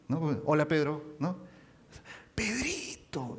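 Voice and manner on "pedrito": higher, soft, very slow Pedrito; affectionate diminutive. Shown as a demonstration. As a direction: sing the nickname out fondly. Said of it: "es diferente". (Pedrito tierno, agudo y muy lento)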